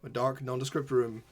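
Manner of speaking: slow, hushed scene-setting narration; game master narration. Acted. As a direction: draw the players into the scene slowly.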